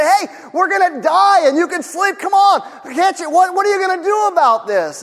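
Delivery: mock panic, we're going to die; panicked disciples. Panicking disciples wake Jesus.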